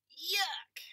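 breathy loud yuck; disgusted cry. Acted. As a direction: recoil as if licked. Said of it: "cried Woolly".

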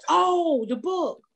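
high, stretched oh of recognition; dawning realization. Retold or imitated. Comedian finally realizing fans mean her book, dawning realization. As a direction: act out the penny dropping.

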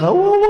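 high loud woof woof, like a yapping dog; barking dog imitation. Preacher mimics the neighbor's dog barking all night, high yapping barks.

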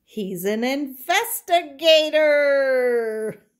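slow, loud, high punchline; Mommy delivers the joke punchline slowly and loudly. Acted. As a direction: land the pun with a big flourish.

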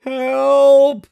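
sudden loud cry of help; shout for help. Acted. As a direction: yell out for rescue. Frankie stuck, calls for help.

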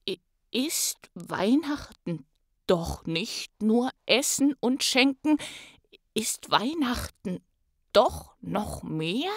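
a hint of the Grinch, slow and wondering. The Grinch asks himself what Christmas means, slow wonder. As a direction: slowly realize something new.

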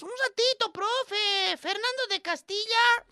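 high, soft pleading pupil; whiny student. Retold or imitated. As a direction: schoolkid who fell behind asks teacher to wait. Student asking teacher to wait.

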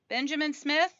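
high, rising call; calling a patient's name. Acted. Nurse to waiting room, calling out. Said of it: "a voice called".

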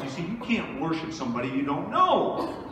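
ordinary talk

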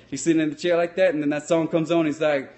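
ordinary delivery

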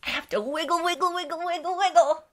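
high, bubbly and quick; giddy wiggle chant for kids; excited wiggle. Shown as a demonstration. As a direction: bounce the words up high with excitement. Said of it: "I get so excited".

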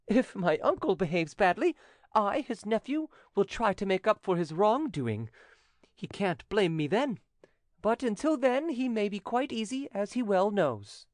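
high-pitched youth, blithely teasing; high, confident nephew; teasing young nephew. Acted. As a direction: tease the uncle in a high young voice.

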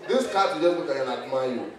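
ordinary delivery

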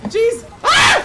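high, loud startled scream; panicked scream. Real, not acted. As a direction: scream in sudden fright. Zombie attack, screaming.

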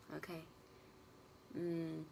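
ordinary delivery

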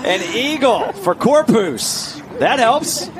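can't contain the joy; laughing excitement. Real, not acted. Laughing after eagle holed.

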